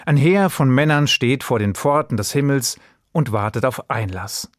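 ordinary talk, nothing marked

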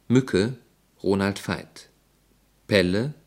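normal talking voice

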